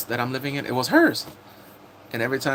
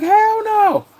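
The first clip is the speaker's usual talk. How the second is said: very high, loud comic rejection; mock outraged refusal; 8 dB louder than usual. Streamer acts out a man rejecting his partner, high loud refusal.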